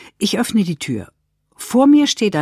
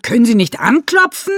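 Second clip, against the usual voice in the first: louder, higher, indignant; Renate yelling; 6 semitones higher, 5 dB louder than usual. Acted. Renate yells at Rockenstroh bursting into the bathroom, loud. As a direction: snap at the intruder, loud. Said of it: "Renate schreit ihn an".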